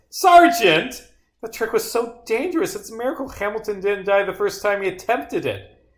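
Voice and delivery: high-pitched rant after a loud opening cry; outraged disbelief. Acted. Officer appalled by the reckless gun trick, outraged high-pitched rant.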